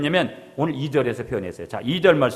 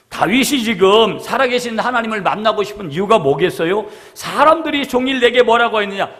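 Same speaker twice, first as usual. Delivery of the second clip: louder, rising preacher's longing; fervent preaching; 8 semitones higher, 10 dB louder than usual. Acted. Pastor preaching to the congregation, fervent and loud.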